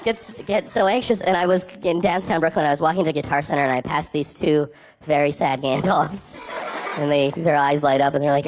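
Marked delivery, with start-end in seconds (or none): none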